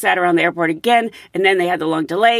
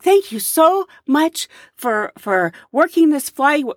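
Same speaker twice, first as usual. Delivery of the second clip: grateful thanks; 6 semitones higher, slower than usual. Retold or imitated. Grateful passengers thanking her.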